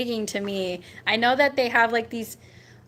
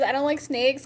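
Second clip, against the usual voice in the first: normal talking voice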